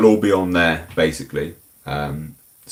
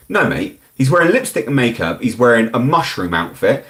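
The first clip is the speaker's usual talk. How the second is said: normal talking voice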